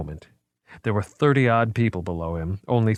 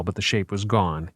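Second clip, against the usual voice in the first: plain reading voice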